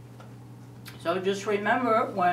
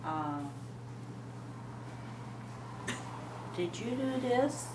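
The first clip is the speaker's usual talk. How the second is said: gentle, much softer request; sweet parent; 11 dB quieter, slower than usual. Retold or imitated. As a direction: ask it tenderly. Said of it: "do you say".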